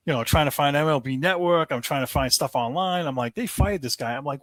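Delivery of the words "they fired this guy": incredulous, voice lifted high; retold incredulity. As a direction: replay your own shocked reaction. Acts out own shocked reaction.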